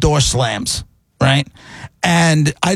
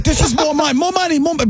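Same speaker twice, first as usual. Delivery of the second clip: high, insistent mock driver repeating "more money"; mimicking angry driver; 11 semitones higher than usual. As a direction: whine it over and over like the pushy driver. Driver voiced in retold argument.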